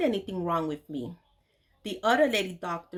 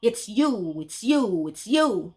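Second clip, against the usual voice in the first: louder, accusing jabs; cruel retort; 4 dB louder, slower than usual. Retold or imitated. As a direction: hammer the accusation home. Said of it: "she retorted cruelly".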